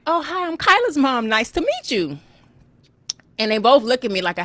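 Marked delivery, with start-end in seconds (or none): 0.1-2.2 overly polite greeting. Retold or imitated. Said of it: "And I was like".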